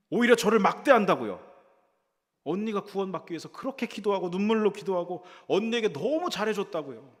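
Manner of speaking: high, loud fuming protest; fuming complaint. Retold or imitated. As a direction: storm at the pastor in hurt anger. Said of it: "씩씩거리면서".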